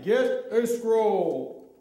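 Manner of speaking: slow, stretched booming command. Shown as a demonstration. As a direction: model the Lord's voice, weighty and slow. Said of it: "It has to be more booming, lower".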